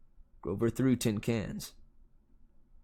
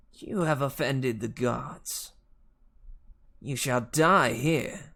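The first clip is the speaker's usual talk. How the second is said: slow, drawn-out pronouncement of doom; lightly voiced monster teacher, very slow; menacing villain; 2 semitones higher, slower than usual. A narrator's reading. Fury teacher condemning the hero, menacing villain. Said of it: "in an accent that was definitely".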